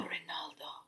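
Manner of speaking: soft unvoiced whisper. A narrator's reading. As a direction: breathe the name secretly. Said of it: "whispered Missus Walush".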